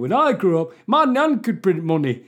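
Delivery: loud mock Yorkshire accent; Geoffrey Boycott. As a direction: grumble it as a put-on Yorkshireman. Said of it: "that does sound like a Boycott argument".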